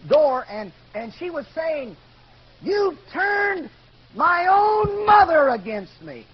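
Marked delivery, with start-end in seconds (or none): 2.6-6.3 loud, high, drawn-out accusing shout; retold scream. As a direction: scream it like an enraged mother. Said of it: "she was screaming at my dad".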